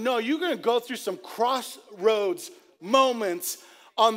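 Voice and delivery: loud, high, urgent sermon voice; full-force preaching. Real, not acted. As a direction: drive the point home to the congregation.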